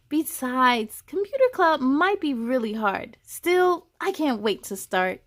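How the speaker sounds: put-on expressive children's story reading; story narration. A narrator's reading. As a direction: read it to a room of kids. Reads a children's book aloud.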